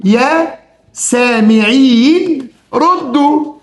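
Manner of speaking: يا سامعين drawn out very slowly as a call; slow calling demo. Shown as a demonstration. Teacher calling out slowly to show students the vocative. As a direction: summon the listeners slowly. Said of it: "بص انا هعمل ايه".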